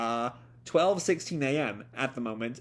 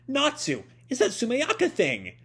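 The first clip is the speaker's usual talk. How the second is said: much higher, urgent call of a small companion; alarmed sidekick; 10 semitones higher than usual. Acted. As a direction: warn your friend in a high panicked voice. Happy alarmed, calling Natsu.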